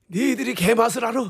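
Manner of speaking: put-on looking down, a teasing old man; Shin Goo ad line. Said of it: "이거 정말 많이 따라했었죠".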